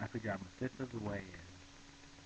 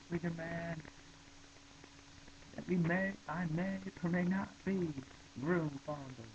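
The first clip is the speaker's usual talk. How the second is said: much higher, gleeful squeaky demand; amused high reprise; 9 semitones higher, slower than usual. Acted. Reader repeating a favourite Broomfondle line, high and gleeful. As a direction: relish the line in a silly voice.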